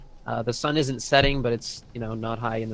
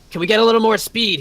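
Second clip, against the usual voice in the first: loud, higher urgent shout; captain shouts; 10 semitones higher, 8 dB louder, faster than usual. Acted. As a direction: bellow the order across the deck. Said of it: "he shouts".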